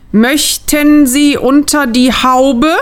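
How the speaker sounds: langsam, jedes Wort einzeln betont, „Möchten Sie unter die Haube?“. Acted. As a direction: Wort für Wort überdeutlich, höher und lauter. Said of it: "Sie betonte jedes einzelne Wort". (übertrieben deutlich, als wäre die Kundin schwerhörig)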